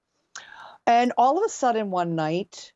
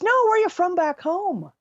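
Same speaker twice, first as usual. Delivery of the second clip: put-on drunk bar girl; 11 semitones higher than usual. Drunk bar girl voiced in story.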